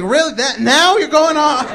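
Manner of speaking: exasperated, high-pitched disbelief; rising, incredulous complaint. Retold or imitated. Incredulous reaction to rooster's timing.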